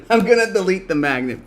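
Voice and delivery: usual speaking voice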